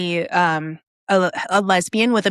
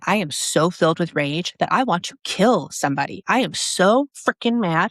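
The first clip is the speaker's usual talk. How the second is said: ordinary delivery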